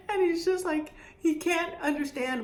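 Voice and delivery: words in a high laugh. Real, not acted. Laughing at the memory.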